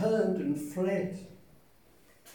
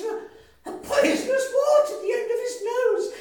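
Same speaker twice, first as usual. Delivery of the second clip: high, loud panicked squeal; terrified mouse; 9 dB louder than usual. Acted. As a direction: gasp and gabble in fright. Mouse meets real Gruffalo.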